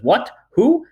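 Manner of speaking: sudden high, loud startled yelp; shocked funny voice. A narrator's reading. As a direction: voice jumps in shock. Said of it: "his voice goes all funny".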